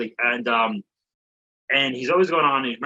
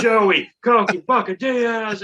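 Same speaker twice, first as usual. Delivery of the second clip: loud drawn-out emcee introduction; big stage intro, stretched out; 9 semitones higher, 5 dB louder, much slower than usual. Retold or imitated. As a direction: announce the comic high and loud, stretching the name. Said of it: "Stephen Kendrick introduces".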